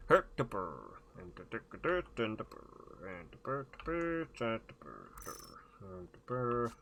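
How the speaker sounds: bubbly mock-Swedish nonsense syllables; Swedish Chef voice. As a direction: burble along in a lilting comic Swedish accent. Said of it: "the Swedish Chef voice".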